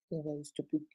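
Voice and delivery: whispered insult under the breath; breathy mocking whisper. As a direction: hiss it almost silently, a mock sneaky aside. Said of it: "like to whisper".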